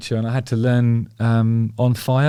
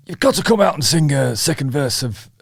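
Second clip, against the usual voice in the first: higher-pitched Yorkshire accent impression; 4 semitones higher than usual. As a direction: put on an approximate Yorkshire accent, raised in pitch. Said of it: "I'm not doing his accent justice".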